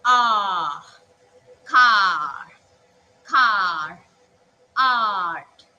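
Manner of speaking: loud, wide-mouthed "ah" in "car" and "art"; teacher drilling the open "ah" sound; open ah words. Shown as a demonstration. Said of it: "open your mouth wide".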